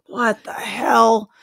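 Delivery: slow, drawn-out retold bewilderment; stunned disbelief. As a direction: stretch out each word in mock astonishment. Retold shock at talking dog.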